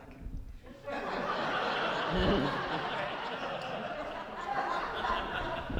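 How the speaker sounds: long airy laugh; long breathy laugh. Real, not acted. Laughs after his punchline.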